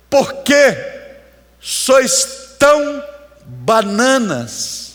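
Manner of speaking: pergunta alta, aguda e bem arrastada; bronca encenada. Acted. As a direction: estique cada palavra numa bronca teatral. Encena Jesus repreendendo os discípulos. (repreensão teatral, arrastada)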